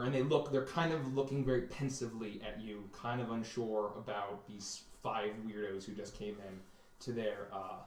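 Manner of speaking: usual speaking voice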